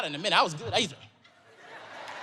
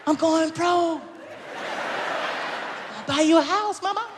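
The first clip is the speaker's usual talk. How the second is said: calling to mama; 8 semitones higher, 3 dB quieter than usual. Retold or imitated. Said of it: "I said".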